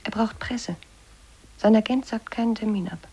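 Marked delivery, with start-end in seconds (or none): 0.0-0.8 soft conspiratorial whisper. Acted. Whispering to David that the guest needs press. Secret backstage talk with David.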